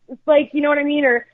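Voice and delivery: usual speaking voice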